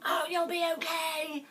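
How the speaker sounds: shrill high shouted comfort; screaming reassurance at a crying person; mock scream. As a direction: yell the comfort absurdly. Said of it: "we don't go screaming at them".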